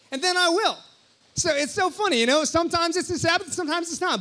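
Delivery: high, loud, bubbly delight; light up at the funny point; excited amusement. Real, not acted. Telling the church about Jason, high and loud with amusement.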